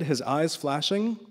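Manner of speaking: usual speaking voice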